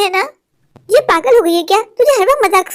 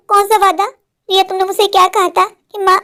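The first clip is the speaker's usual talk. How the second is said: ordinary talk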